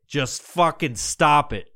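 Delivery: ordinary delivery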